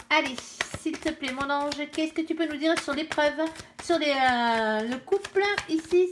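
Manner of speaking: asking the cards' angel for guidance; plea to her angel. Real, not acted. Invokes her angel before reading.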